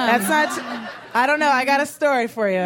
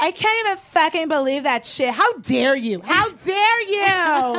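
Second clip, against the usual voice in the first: indignant scolding; 4 semitones higher than usual. Retold or imitated. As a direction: scold him like outraged friends. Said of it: "They're like".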